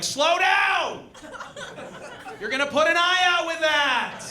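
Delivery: high, shrill-edged scolding mother; nagging mom voice. Retold or imitated. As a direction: scold like a single mom mid-sex. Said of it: "she would say things to me like".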